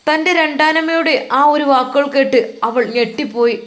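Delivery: usual speaking voice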